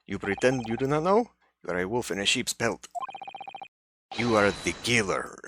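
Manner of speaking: shaky attempt at a Russian accent. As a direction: accuse in an improvised Russian accent. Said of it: "this is not the accent to take".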